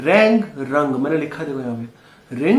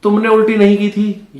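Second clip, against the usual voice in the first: ordinary talk, nothing marked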